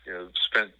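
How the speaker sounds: ordinary talk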